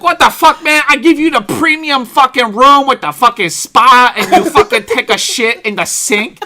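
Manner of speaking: put-on loud, high accented rant; indignant hotelier impression, shouted and hammed up. Manager berating guests over the spa room, yelled in mock accent.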